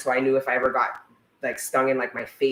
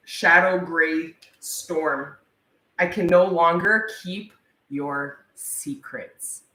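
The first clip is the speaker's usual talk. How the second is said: slow dramatic reading; 3 semitones higher, slower than usual. Acted. Dramatic reading of card text.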